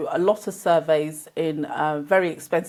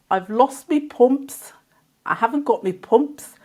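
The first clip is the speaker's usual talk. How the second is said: singsong Brummie, pitched up; Birmingham accent; 5 semitones higher than usual. Retold or imitated. Schoolchild telling the teacher about lost pumps, Birmingham accent. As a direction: put on a lilting Birmingham accent. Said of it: "sounds as if we're singing".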